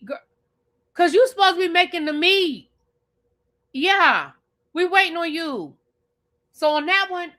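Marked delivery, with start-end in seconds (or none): 0.9-5.8 mock raised voice. Voicing Amanda's group.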